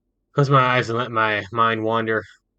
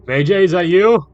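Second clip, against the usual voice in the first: higher, louder call of a mother up the stairs; mature woman calling; 8 semitones higher, 10 dB louder than usual. Acted. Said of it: "Mature sounding female voice calls down the stairs".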